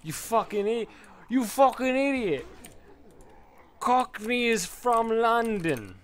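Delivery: loud, high-pitched mock British outrage; exaggerated British accent. Imagined British viewers correcting the streamer, outraged British accent. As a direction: holler like an indignant caricature Brit. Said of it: "British viewers, and they're probably like".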